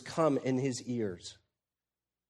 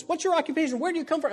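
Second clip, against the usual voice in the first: much higher, exasperated demanding questions; exasperated sailors; much faster than usual. Retold or imitated. Sailors exasperated with Jonah, demanding high and urgent. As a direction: fire the questions at him in disbelief. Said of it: "You can hear the exasperation in their voice".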